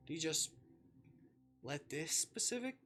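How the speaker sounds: ordinary talk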